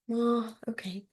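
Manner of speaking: breathy whispered aside. Real, not acted. Therapist whispering a resigned aside to the class. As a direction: let it out on a sigh, almost no voice.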